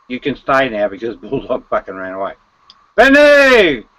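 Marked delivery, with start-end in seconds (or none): 3.0-3.9 loud call across the room; excited loud call. Real, not acted. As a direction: shout his name to get his attention. Calling out to a friend.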